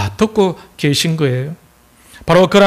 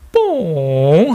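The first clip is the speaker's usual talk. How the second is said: dainty fart noise; much slower than usual. Acted. Seoul girlfriend farting beside her boyfriend, very daintily. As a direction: imitate a gentle little fart, stretched out. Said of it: "아주 살포시".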